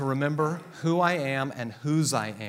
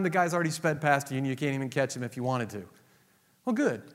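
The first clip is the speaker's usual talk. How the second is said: ordinary talk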